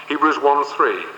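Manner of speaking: normal talking voice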